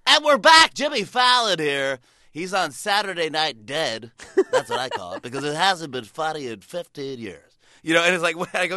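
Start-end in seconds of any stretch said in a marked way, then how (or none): 0.1-7.6 on-air shock DJ. Retold or imitated. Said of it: "They go like".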